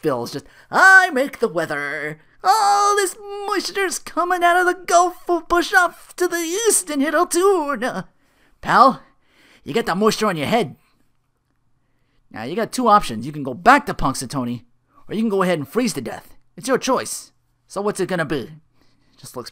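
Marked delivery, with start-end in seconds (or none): 0.7-8.1 much higher, slow, self-important forecast voice; smug weatherman. Retold or imitated. Phil boasting as weatherman. 8.6-18.5 tough cop. Retold or imitated. Cop ordering Phil back.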